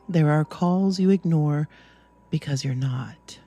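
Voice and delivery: ordinary delivery, nothing marked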